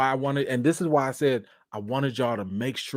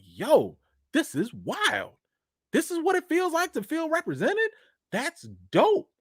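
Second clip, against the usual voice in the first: amazed wonder. Retold or imitated. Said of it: "And I was like".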